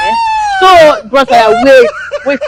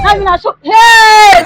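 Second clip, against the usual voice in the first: louder shout rising in pitch; 8 semitones higher, 8 dB louder, slower than usual. Acted. Rambling woman shouts at the stranger, loud outburst. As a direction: blurt it out at full volume.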